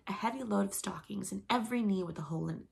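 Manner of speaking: usual speaking voice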